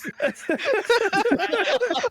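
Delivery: loud open laughter; hearty laugh. Real, not acted.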